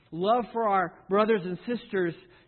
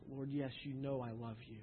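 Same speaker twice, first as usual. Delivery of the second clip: crushed confession; 6 semitones lower, 13 dB quieter than usual. Acted.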